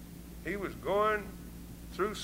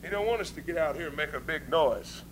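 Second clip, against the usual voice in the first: ordinary talk, nothing marked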